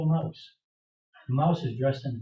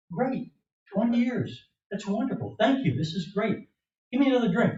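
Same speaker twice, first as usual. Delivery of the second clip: high-pitched, acted delight; delighted relief; 9 semitones higher than usual. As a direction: play the grateful cured man, voice lifted high. Cured man's delight in joke.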